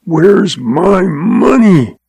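very slow, each word pressed; slow insistent demand. A narrator's reading. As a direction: drag out the demand. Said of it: "rasped Lem Craig".

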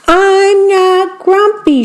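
high, slow cartoon shout; storybook monkey bellowing his denial. A narrator's reading. Gorilla Jim shouts at the cheerful animals that he is not grumpy. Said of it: "shouted Jim".

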